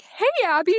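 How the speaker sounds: high, loud, sing-out hello; excited greeting. Retold or imitated. Gymnasts calling out to her as she walks in, excited greeting. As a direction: greet her like a whole gym spotting a friend.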